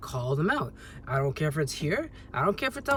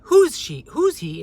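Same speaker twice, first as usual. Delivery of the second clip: mimicking a rude, much higher and louder "who's he"; rude challenge; 10 semitones higher, 9 dB louder, slower than usual. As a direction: throw it at him like he doesn't belong. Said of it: "rude, adversarial, visceral tone".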